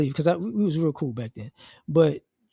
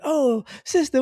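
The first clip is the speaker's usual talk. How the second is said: high, delighted "oh, sister"; mock warm hail; eager greeting; 10 semitones higher than usual. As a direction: greet her like long-lost family. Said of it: "I was like".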